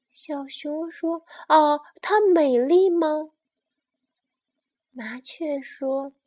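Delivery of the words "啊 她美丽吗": loud, higher, eager question; excited little bear. Acted. Bear asks sparrow about mama's beauty, excited. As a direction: gush it as a delighted cub.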